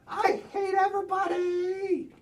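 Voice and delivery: shouted, tearful outburst; angry shout. Acted. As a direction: yell it, close to tears. Character bursts out in anger.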